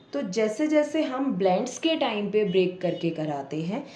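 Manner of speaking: ordinary talk, nothing marked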